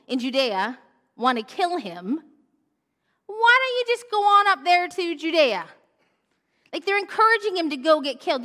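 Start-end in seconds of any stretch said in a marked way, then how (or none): 3.3-5.7 loud, high taunting challenge; mocking sibling dare. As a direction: goad him like a jeering brother. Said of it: "his four brothers say".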